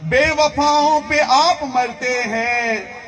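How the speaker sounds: measured, projected mushaira recitation; poetry recital. Acted. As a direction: declaim the verse to the crowd, stretching the line ends. Recites Urdu poetry to audience.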